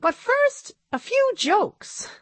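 very high squeaky puppet voice; high puppet-show monkey. A narrator's reading.

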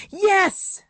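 high, gleeful cheer; exclaiming. A narrator's reading. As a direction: celebrate the win. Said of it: "exclaimed Annabelle silently".

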